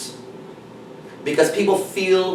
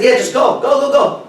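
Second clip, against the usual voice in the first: urging him on; 8 semitones higher, 6 dB louder than usual. Retold or imitated. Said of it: "And I said".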